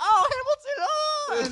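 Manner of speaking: high, loud squealing parody; mock a gushing musical fan; hammed-up theater kids. Mimics theater kids reacting at Denny's.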